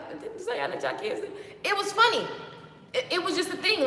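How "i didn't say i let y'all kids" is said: laughing retort. Retold or imitated. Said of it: "I was like".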